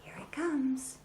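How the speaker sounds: whisper. Real, not acted. Teasing the toy's arrival.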